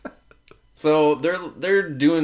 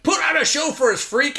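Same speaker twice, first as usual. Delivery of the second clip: much higher sneering taunt; mocking thugs; 13 semitones higher than usual. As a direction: jeer at her like a bully. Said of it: "they're literally like".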